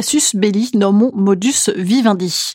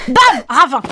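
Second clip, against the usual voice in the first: sudden shout; 3 semitones higher, 3 dB louder than usual. Pretend. Sudden fall exclamation.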